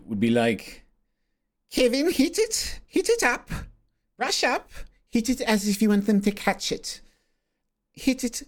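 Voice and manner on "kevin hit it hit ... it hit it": high-pitched mock South African accent; hammed-up South African coach calling out drills. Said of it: "Well, it's a horrible accent".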